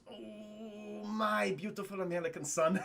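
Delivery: soft mock-fatherly tone; tender mock father. Russian soldier coos over the lost man, softly. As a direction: coo it like a doting dad. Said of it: "he goes".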